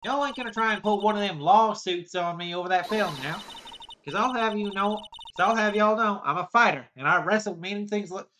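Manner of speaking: lightly voiced angry southern woman scolding; a hint of a riled-up country gal. Southern woman scolding the kids over ruined film, riled.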